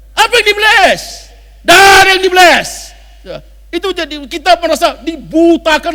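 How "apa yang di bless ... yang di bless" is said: loud, high shouted challenge; shouted rebuke. Shown as a demonstration. Preacher shouting at the congregation about fake blessings. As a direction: blast the question at them.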